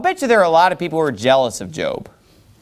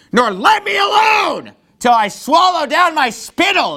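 building anger, climbing higher line by line; angry scripture reading; 5 semitones higher than usual. Acted. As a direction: read it with mounting anger, ending in a shout. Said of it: "you can feel the anger".

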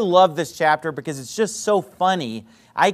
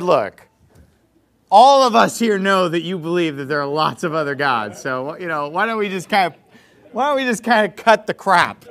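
embellished bluntness. Retold or imitated. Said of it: "this is all very embellished".

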